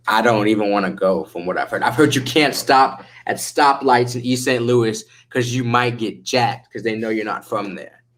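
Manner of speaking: ordinary talk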